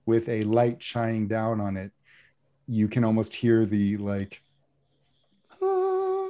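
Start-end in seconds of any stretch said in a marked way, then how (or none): 5.6-6.3 high sung ah like a heavenly choir; mock angelic choir note; choir sound effect.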